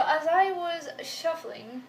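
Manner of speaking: normal talking voice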